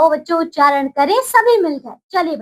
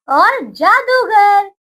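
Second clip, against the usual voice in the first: slow, drawn-out dictation; 4 semitones higher, much slower than usual. Shown as a demonstration. Teacher to children, slow clear dictation. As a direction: stretch each syllable of जादूगर slowly.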